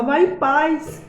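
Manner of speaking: very slow and tender, like a blessing; gentle blessing. Retold or imitated. Barber forgives the man. ("Vai em paz" dito bem devagar, com ternura)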